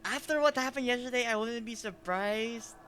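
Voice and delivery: normal talking voice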